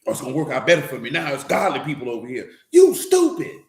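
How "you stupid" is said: shouted high, flat verdict: you stupid; blunt loud scolding. Acted. Preacher shouting down foolish listeners, loud blunt scold. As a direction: bark it out like calling a fool out.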